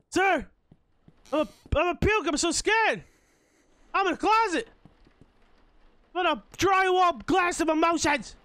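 high, loud frightened cries; scared yelling. Real, not acted. Trapped player calling for help, yelling in fright. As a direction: yell out in real fright.

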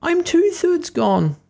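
very high, very slow squeaky lament; tiny cookie fading away, drawn out; gingerbread man voice. Acted. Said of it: "said the gingerbread man".

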